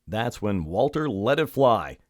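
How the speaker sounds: usual speaking voice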